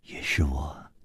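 low, very soft, understated reply; indifferent. A narrator's reading. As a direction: admit it quietly and coolly. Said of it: "淡淡的说". (淡淡地低声说)

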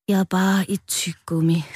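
understated dejected confession; mildly sad. A narrator's reading. Rød admits what he really is, mildly sad. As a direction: confess it with a touch of gloom. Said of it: "Rød svarede bedrøvet".

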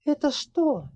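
high, surprised little question. A narrator's reading. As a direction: ask in sudden surprise. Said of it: "с удивлением поинтересовалась девушка".

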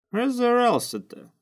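slow, high, drawn-out jeer; lazy retort. A narrator's reading. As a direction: drag it out lazily, high and loud. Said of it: "лениво ответил Факер". (лениво, врастяжку)

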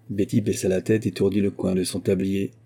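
ordinary delivery

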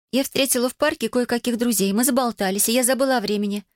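put-on high-pitched girl making excuses; defensive teenage daughter. A narrator's reading. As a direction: lift the voice high and justify yourself. (подросток оправдывается высоким голосом)

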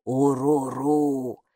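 slow, low creature grumble; meaningful grumble. Acted. Бука, лесное существо, многозначительно ворчит «Уруру» Майке.

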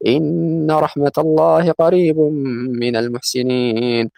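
slow chanted Quran quote; Quran recitation. Acted. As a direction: intone the verse slowly like recitation. Quotes Quran verse in lesson.

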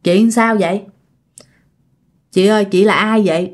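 low, careful respectful questions; father's polite questioning. Retold or imitated. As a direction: ask a possessed stranger politely, pitched low. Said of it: "ba mới hỏi rằng á là".

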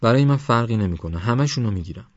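usual speaking voice

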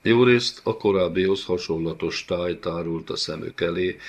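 ordinary delivery, nothing marked

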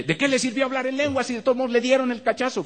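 high, mock doubting voice of a skeptic; imitating a scoffing listener. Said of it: "Y uno dice".

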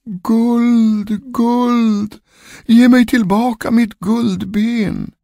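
high, long-drawn wail on one note; ghostly calling voice. A narrator's reading. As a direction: wail it from beyond the grave. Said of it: "ropade en röst från kyrkogården". (klagande, utdraget spökrop)

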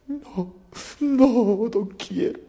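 high-pitched mock crying; tearful simpleton. Simpleton refusing the king's offer, acted high tearful wail. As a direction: whine the refusal in a put-on sob. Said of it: "con lágrimas en los ojos, llorando".

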